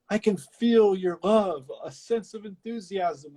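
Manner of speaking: hammed-up con man delight; mock smug showman. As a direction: savor it like a self-satisfied huckster. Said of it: "he's like".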